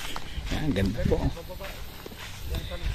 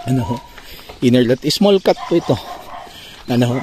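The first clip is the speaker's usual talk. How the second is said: ordinary talk, nothing marked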